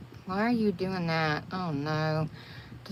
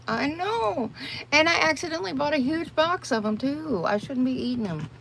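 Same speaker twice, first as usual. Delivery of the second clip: high guilty squeal; 9 semitones higher than usual. Real, not acted. Guilty confession about cheese sticks.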